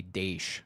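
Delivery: "daish" said with an sh ending; own regional pronunciation. Shown as a demonstration. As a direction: demonstrate your odd way of saying "daish". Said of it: "the way that I say".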